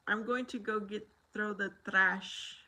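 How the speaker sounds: put-on a mild Mexican Spanish accent, as a little boy picked it up; mother imitating her son's cute Mexican Spanish accent. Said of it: "he did pick up my dad's accent".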